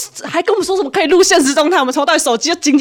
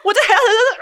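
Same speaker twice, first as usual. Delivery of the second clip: normal talking voice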